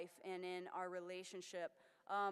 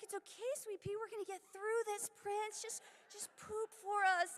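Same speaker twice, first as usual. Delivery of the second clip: much higher, sing-song pet voice; baby talk to pet; 11 semitones higher, 4 dB louder than usual. Retold or imitated. Comforting the constipated kitten in high baby talk.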